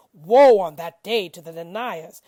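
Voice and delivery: woe cried out loud and strong; loud proclamation. Acted. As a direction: proclaim the woe at full volume. Reciter proclaims woe on deniers.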